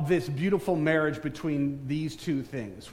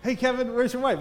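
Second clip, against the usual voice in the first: lightly mimicked high-pitched call; mock-eager, higher voice; imitated eager askers; 8 semitones higher, 3 dB louder than usual. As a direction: play people calling out a question, pitched up. Imitates people asking after his wife.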